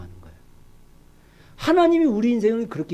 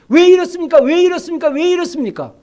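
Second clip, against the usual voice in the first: doubting skeptic; 6 semitones higher, 4 dB louder than usual. Acted. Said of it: "모든 게 다 의심스러운 거예요".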